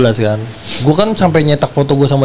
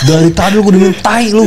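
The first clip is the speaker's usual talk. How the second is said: retold angry shout; 7 semitones higher than usual. Telling off the boyfriend on the call, mock anger. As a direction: spit it at the new boyfriend. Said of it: "gua bilang".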